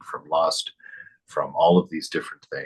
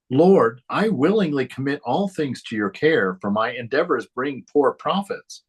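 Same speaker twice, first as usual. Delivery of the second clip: higher, lilting devotional read-aloud; earnest recited prayer, pitched up; 5 semitones higher than usual. Acted. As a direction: read the disciple's prayer up to the Lord. Reads disciple's prayer aloud.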